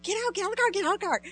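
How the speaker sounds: excited urging. Retold or imitated. Eager leader hurries kids out.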